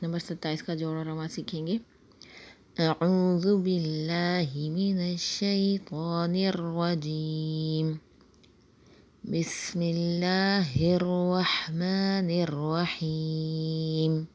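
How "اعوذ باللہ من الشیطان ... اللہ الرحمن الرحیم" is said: slow, drawn-out tajweed recitation; slow Quranic chant. Shown as a demonstration. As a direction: recite each word slowly and measured. Teacher recites before the lesson.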